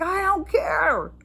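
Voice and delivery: high, drawn-out retold yell; angry dismissal. Angry caller brushing off agent's excuse, exasperated yell. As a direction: wail it slowly, way up high. Retells furious reply to agent.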